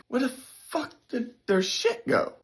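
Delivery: high, hushed bewilderment; baffled disbelief. Retold or imitated. Soldier finds roommates' stuff gone, baffled.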